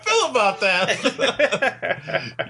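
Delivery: high, slow, laughing reply. Real, not acted.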